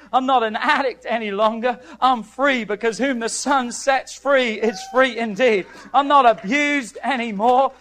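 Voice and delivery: loud, impassioned declarations of faith; full-force preaching. Acted. Preacher declaring freedom from an old label, full-force preaching. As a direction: punch each declaration out to the congregation.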